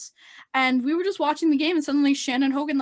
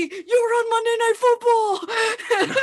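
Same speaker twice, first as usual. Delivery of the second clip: high, loud, slow announcement; excited shouting; 9 semitones higher, 5 dB louder, slower than usual. Retold or imitated. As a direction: shout the big news, laughing with excitement. Retold excited text about TV.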